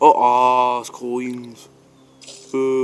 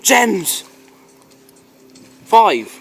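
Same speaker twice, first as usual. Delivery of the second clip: shrieking jackpot winner; 8 semitones higher, 6 dB louder than usual. Real, not acted. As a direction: gasp into a high, loud cry. Big prize after gasp.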